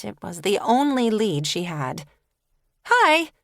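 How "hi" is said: high, loud, overly cheerful greeting; too cheerful. Acted. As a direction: blurt it out too brightly, breathless. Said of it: "she blurted too cheerfully, too breathlessly".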